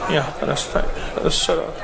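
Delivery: quiet, slow murmured recitation; soft recitation. Acted. Imam reciting Fatiha along with the crowd, quiet. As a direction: recite almost under the breath.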